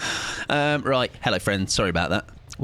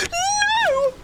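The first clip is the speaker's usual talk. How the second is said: high, wailing fake no; mock cry. Streamer pretending to cry over the game, high wail. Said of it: "I will do a cry".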